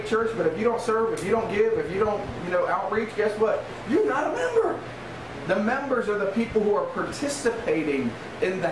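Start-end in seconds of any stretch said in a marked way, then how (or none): 3.9-4.8 high-pitched emphatic punchline; emphatic rebuke. Real, not acted. Preacher to those who don't serve, emphatic rebuke. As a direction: burst up high to drive it home. Punchline aimed at uncommitted members.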